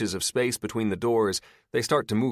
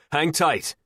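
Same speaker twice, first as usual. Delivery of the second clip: calling out; 8 semitones higher, 5 dB louder than usual. A narrator's reading. Comforting call through doors.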